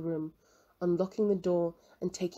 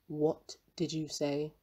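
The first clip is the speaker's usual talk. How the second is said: slow, lower, menacing question; low bitter note; 3 semitones lower, slower than usual. A narrator's reading. Old professor, stung, turns slow and bitter. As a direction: let the bitterness sink the words down slowly. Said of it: "his voice had changed to a low, bitter note".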